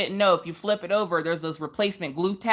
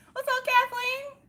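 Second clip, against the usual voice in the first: falsetto greeting; 3 dB quieter, slower than usual. Real, not acted.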